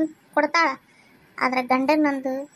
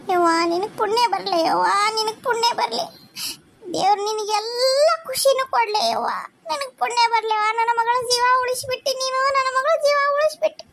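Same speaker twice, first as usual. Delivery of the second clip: higher, crying gratitude, rising to much higher; tearful blessing; 5 semitones higher than usual. Acted. Mother blesses daughter-in-law for saving her daughter, weeping. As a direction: bless her through tears of thanks.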